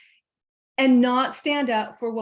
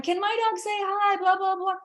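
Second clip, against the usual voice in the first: much higher, mock whiny owner's plea; mocking pushy owner; 10 semitones higher than usual. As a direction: parody the owner begging from afar.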